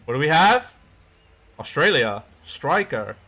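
badly faked Australian accent; horrible Australian accent. Gamer announcing the player from the pack, bad Australian accent. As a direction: have a clumsy go at an Australian accent. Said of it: "That was a horrible Australian accent".

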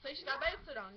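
ordinary talk

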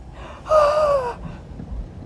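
very high drawn-out gasp; hammed-up squeaky wail; mock wailing gasp. Driver appalled at the street, mock wailing gasp.

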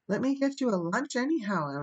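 high-pitched eager plea; imploring. A narrator's reading. Anne pleading with her departing guest, imploring. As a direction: beg her to stay. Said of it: "implored Anne".

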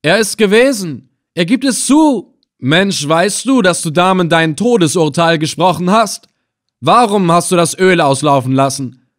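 high, loud, accusing outburst; excited calling out. A narrator's reading. Captor announces prisoner's confession to his men, excited calling out. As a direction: proclaim his guilt to everyone. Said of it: "rief er dann".

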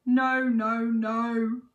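loud, drawn-out groan. A narrator's reading. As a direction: groan in royal dismay. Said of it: "groaned the fat king".

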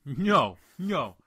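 loud, high sulky No; pouting. Acted. Mocks friend's refusals with a loud, pouting No. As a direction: bark No. like a sulking kid. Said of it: "Just, like, pouting".

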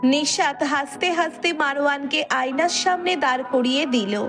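ordinary delivery

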